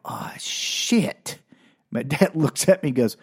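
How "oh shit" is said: soft, whispered mock alarm; hushed dread. Boy looks at dad at the door, whispered dread. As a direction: whisper it as if you are doomed.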